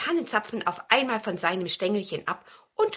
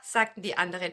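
plain reading voice

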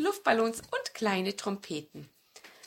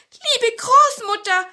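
high, slow, loud dwarf voice; a tiny dwarf squeaking up at the door; little dwarf; 9 dB louder, slower than usual. A narrator's reading. Dwarf warning grandmother at her door, high and loud. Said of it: "sagte der Zwerg".

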